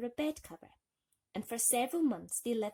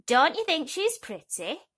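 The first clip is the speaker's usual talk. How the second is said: higher, much louder toad; proud toad; 8 semitones higher, 12 dB louder than usual. Acted. Mother toad showing Thumbelina to her son, loud and proud. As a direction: show off the catch. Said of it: "she called to her son".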